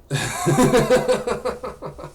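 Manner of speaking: high, loud burst of real laughter; real laughing fit. Real, not acted. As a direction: crack up at the joke. Laughs at a joke.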